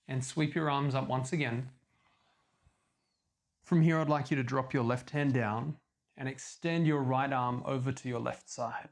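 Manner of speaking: normal talking voice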